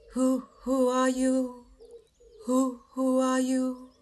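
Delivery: slow, hooting bird call; wood pigeon hoot. A narrator's reading. Pigeon hooting over lost children, slow and eerie. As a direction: coo it like a pigeon in the woods.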